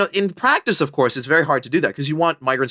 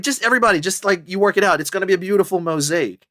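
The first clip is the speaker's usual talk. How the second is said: breezy mock Canadian; 7 semitones higher than usual. Voicing Canada's carefree attitude.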